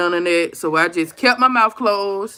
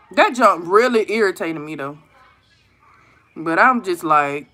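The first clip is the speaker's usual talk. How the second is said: ordinary talk, nothing marked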